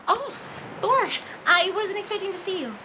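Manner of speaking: startled and a little guilty; caught off guard. Acted. Nymphia caught off guard as Thor walks in, high flustered surprise.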